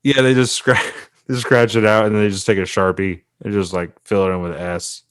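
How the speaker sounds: ordinary delivery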